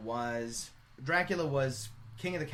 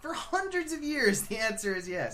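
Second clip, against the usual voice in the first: emphatic; 13 semitones higher, 7 dB louder than usual. Real, not acted. Emphatic dramatic contradiction.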